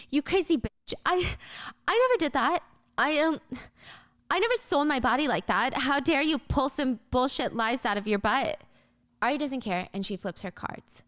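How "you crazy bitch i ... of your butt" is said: high, indignant outburst; outraged. Acted. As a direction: fire back, stung by the lie. Yuri accused, explodes at Ari.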